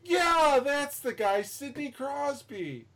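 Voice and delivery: eager vet. Retold or imitated. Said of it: "And he goes".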